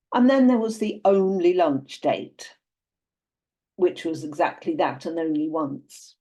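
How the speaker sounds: usual speaking voice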